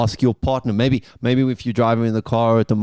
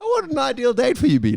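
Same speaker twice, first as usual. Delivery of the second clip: squeaky high-pitched mimicry; mocking high voice; 13 semitones higher than usual. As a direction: parrot it back in a silly high voice. Mocks the awkward question.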